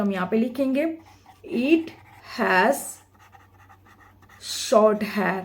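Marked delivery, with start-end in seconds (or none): 1.5-5.4 slow dictation. Shown as a demonstration. Dictating the answer.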